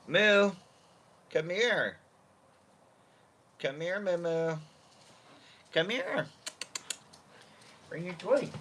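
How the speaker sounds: high pet-talk voice; high calling voice for the dog. Real, not acted. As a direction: coo at the puppy.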